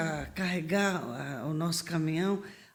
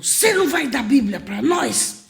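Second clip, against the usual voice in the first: louder, higher soldier voice; soldier asking; 6 semitones higher, 8 dB louder than usual. Retold or imitated. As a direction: plead for a bible. Soldier asks for bible.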